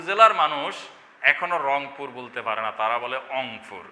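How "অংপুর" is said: অংপুর with the initial r dropped; dialect mispronunciation. Shown as a demonstration. Teacher showing how Rangpur locals say it, r-less pronunciation. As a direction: start অংপুর on the bare vowel অ, no র. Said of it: "তারা র উচ্চারণ করতে পারে না, অ দিয়ে শুরু করে".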